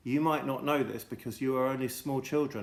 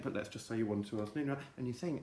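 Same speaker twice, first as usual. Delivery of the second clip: ordinary delivery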